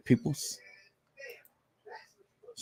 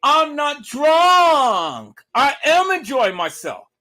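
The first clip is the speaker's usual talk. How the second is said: drawn-out, very slow mock shout, higher and louder; imitating Kanika's words; 10 semitones higher, 4 dB louder, slower than usual. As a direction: stretch each word out loud and high as if slurring a protest. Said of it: "the very last words that Kanika uttered was".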